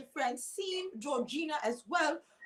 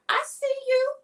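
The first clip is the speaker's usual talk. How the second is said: I see you pitched way up and loud; high-pitched I see you; 12 semitones higher, 5 dB louder than usual. Shown as a demonstration. Host demonstrating the phrase to the stage, high-pitched and loud. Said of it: "it's very high pitched".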